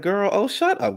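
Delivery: high, sassy snap of shut up; mocking Sutton. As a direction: brush her off like Sutton would. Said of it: "Sutton is like".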